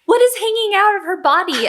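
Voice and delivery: high-pitched, retold astonishment; baffled disbelief. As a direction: act out bewildered shock at the sight. Retelling her baffled reaction.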